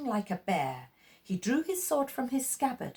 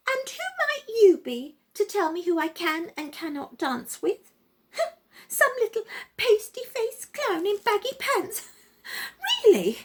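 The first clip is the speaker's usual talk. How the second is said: high, taunting, laughing at him; scornful mockery; 12 semitones higher, 4 dB louder than usual. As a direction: sneer at the little clown. Luba mocks Peter through window.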